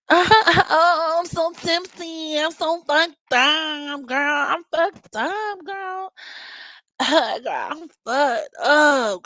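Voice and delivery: high, drawn-out tipsy girl voice mocking a Leo; drunk party girl impression. Mocking drunk Leo girls at a party, high and drawn-out. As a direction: ham up a sloppy drunk girl wailing about her head. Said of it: "They'll probably act drunker than they really are".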